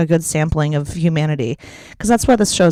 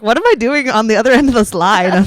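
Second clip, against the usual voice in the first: mock shocked caller; 6 semitones higher, 8 dB louder than usual. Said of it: "I was like".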